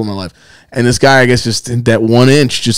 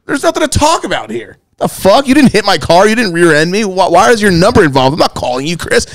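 high-pitched outraged rant; indignant rant; 7 semitones higher than usual. Real, not acted. Venting to his friend about the note, high outraged rant. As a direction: vent in disbelief, pitch way up.

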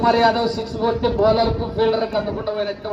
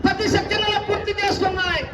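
high-pitched round announcement; sustained high commentary chant; race announcer; 10 semitones higher than usual. Real, not acted. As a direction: call the round result high over the crowd.

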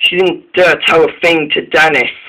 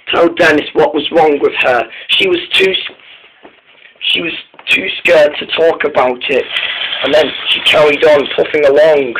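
ordinary delivery, nothing marked

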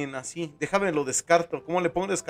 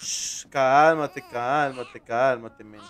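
put-on slow, falling shh to calm a crying baby; hushing; 5 semitones lower, much slower than usual. Hushing the crying game baby, slow and soothing. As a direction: ease each cálmate out slowly, dropping lower.

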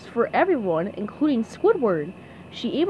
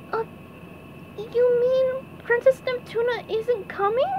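high, small crestfallen question; SpongeBob voice; 12 semitones higher than usual. A narrator's reading. SpongeBob hearing the princess isn't coming, high dismayed question. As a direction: ask it high, in dawning dismay.

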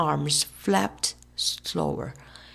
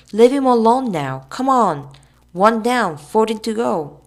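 raised voice, higher; loud; 4 semitones higher, 8 dB louder than usual. Acted. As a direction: call out firmly to break it up. Tom breaks it up loudly.

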